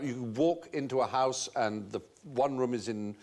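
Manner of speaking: normal talking voice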